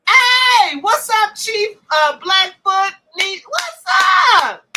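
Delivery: loud, high hey across the room; greeting a newcomer to the stream, loud and high; calling out a greeting. Real, not acted. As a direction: shout a welcome to a friend arriving. Greeting someone coming in.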